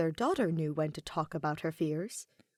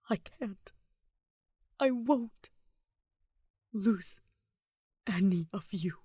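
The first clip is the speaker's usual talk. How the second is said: choked whisper. Acted. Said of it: "barely above a whisper as he choked out".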